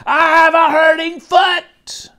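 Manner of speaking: put-on high, loud wail from a child; shouting. A narrator's reading. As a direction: call out slowly to someone far ahead. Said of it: "I had to shout".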